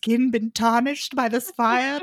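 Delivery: a lightly put-on fussing voice; mock doting Brendan. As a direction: ham up his swooning worry over her skin. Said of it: "He's like".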